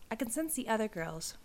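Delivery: ordinary delivery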